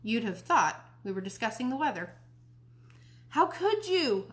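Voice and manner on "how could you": चिल्लाकर, ऊँची आवाज़ में, धीमे-धीमे; ऊँचे सुर में टूटकर पूछो; shouting. Acted. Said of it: "I shouted".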